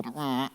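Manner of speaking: low, quiet grunt of reluctance; reluctant grunt. Acted. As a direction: grumble softly at the hard task. Voices reluctance at hard passage.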